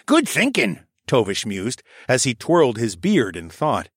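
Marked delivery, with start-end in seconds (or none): 0.1-0.8 put-on raised, lively old scholar voice; old koala elder voice. A narrator's reading. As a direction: voice the old archivist, a touch higher. Tovish mused.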